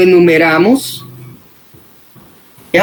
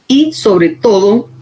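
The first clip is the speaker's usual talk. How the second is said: ordinary talk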